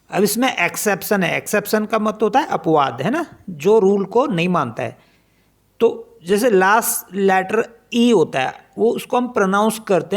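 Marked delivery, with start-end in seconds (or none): none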